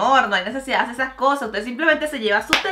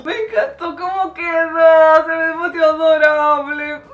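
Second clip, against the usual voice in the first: gushing adoration; 4 semitones higher, 8 dB louder than usual. Real, not acted. Gushing to viewers over an adorable painting, louder and higher. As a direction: gush over it like a cute pet.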